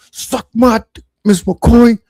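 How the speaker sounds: high, cocky insult at the teacher; mock defiant retort. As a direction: fire back at the teacher like a rude kid. Student insulting teacher, retold.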